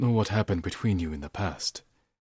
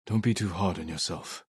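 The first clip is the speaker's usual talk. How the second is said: ordinary talk, nothing marked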